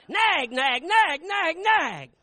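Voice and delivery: high loud mocking nag; mock nagging. Preacher mimicking a nagging wife, high and loud. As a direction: hammer out the nags up high.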